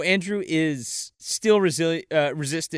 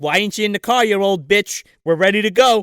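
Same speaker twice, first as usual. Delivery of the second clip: loud, higher, impatient mock scolding; pissed-off aunt; 4 semitones higher, 7 dB louder than usual. Aunt hurries grandma to the car, loud pissed-off scolding. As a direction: berate with rising force. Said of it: "Aunt Ruth is pissed".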